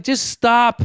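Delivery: high, loud, fed-up outburst; exasperated plea. Retold or imitated. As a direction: snap as if at chattering kids. Retold urge to snap at kids.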